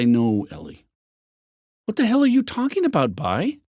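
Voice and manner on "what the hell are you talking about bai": high, fast, disbelieving outburst; shocked high-pitched demand; incredulous. Acted. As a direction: demand to know what he means. Ellie startled and confused.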